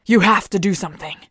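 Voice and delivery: breathless and rushed; panting. Acted. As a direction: gasp it out between breaths. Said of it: "Ark panted".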